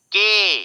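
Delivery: "के" held long on ay, high and loud; showing the vowel. Shown as a demonstration. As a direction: stretch the vowel of "के" loudly. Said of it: "ए sound".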